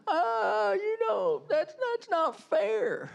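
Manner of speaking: high, slow mock-crying whine; whiny protest. Preacher mimicking believers poked by the world, whiny mock wail. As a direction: wail it like a sulky child, drawn out.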